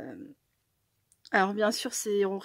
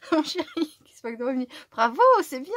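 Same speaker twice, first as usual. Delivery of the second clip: cooing praise to pet; 5 semitones higher, 8 dB louder, faster than usual. Real, not acted. Praising her cat playfully.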